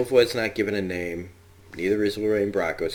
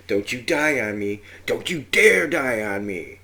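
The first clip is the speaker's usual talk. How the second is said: melodramatic movie line; 3 semitones higher, 6 dB louder than usual. Acted. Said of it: "an excuse to use the lines".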